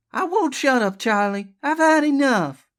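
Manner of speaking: high, loud fed-up refusal; defiant. Acted. Elizabeth has had enough of Charlie, defiant. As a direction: stand up to him loudly. Wife defies armed man.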